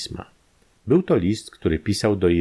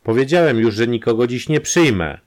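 loud, raised refusal shouted at the door; irritated yell, higher and louder; angry shout; 5 semitones higher, 5 dB louder than usual. A narrator's reading. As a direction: shout him off, you won't see anyone. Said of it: "krzyknął Ballow".

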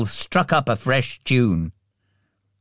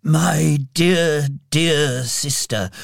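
put-on slow, lingering, moved old Scrooge; tearful old miser; 3 semitones higher, slower than usual. A narrator's reading. Old Scrooge remembers his sister, tearfully. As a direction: draw out each dear with feeling. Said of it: "as tears fell freely down his cheeks".

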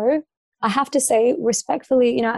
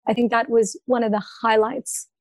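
usual speaking voice

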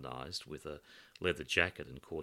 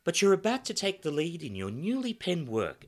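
high, theatrical society hostess; grand lady voice; 13 semitones higher, 9 dB louder than usual. Acted. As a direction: play it like a gushing hostess. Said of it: "announced Samantha".

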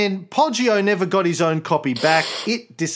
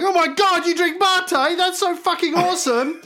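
excited fan. Retold or imitated.

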